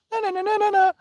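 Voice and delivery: high sing-song na-na taunt; mocking taunt. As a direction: tease like a bratty kid. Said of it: "Already defensive".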